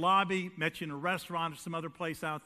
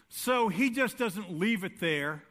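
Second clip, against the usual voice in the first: ordinary talk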